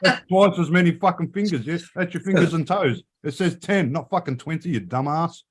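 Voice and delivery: high-pitched sarcastic scolding, laughing at the end; mock telling off. Mocking shopper who can't count, high sarcastic rant. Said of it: "So I say to the actual person".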